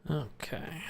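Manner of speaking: breathy whispered okay; whispering. Real, not acted. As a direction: whisper it under your breath. Quiet aside while playing.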